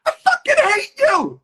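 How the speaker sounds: very high, loud joyful shout; delighted fan telling the villain actor he hates him; gleeful hate. Retold or imitated. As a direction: yell it with delight, not anger. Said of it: "And he goes".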